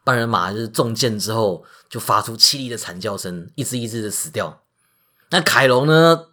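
ordinary delivery, nothing marked

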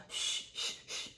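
urgent breathy shush; a hushed retold whisper; hushing whisper. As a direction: shush him in a whisper so no one hears. Owner hushing him at door.

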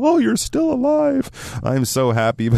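acted high-pitched squeal of relief and joy; overjoyed. Seeing a friend thought long dead, acted overjoyed surprise. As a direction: gush happily, voice leaping up high. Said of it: "overcome by happiness".

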